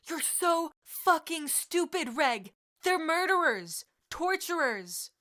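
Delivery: high, loud, slow yelling; furious shout. Acted. Sirius yelling at Regulus about murderers, furious shout. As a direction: rage at him.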